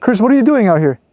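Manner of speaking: uneasy shout; calling out. Real, not acted. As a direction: call him from a distance. Calling friend in the woods.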